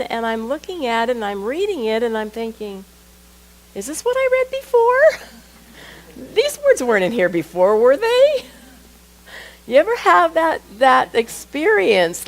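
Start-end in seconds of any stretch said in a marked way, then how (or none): 3.7-8.5 high, incredulous questioning; baffled disbelief. Retold or imitated. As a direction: act out puzzled double-take at the page. Acts out own baffled re-reading.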